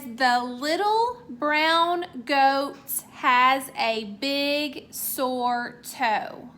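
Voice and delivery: reading each word out very slowly; slow read-aloud. Shown as a demonstration. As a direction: read the sentence slowly, as if for young kids. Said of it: "It says".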